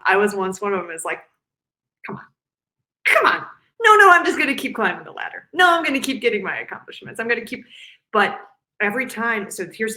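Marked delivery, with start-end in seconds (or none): 2.1-7.6 higher, louder, fast insistent protest; mock driven protest. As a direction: brush off the advice, racing ahead. Mocking high achieving women.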